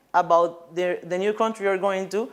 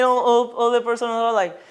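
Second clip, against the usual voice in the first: ordinary delivery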